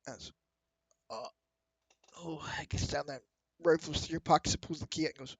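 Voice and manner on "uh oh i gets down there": usual speaking voice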